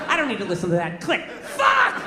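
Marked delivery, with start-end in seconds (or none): 1.4-2.0 high-pitched burst of exasperation; frustrated yell. Acted. Caller cut off by the hang-up click, yelling in frustration. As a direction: let the curse explode way up high. Caller cursing after being hung up on.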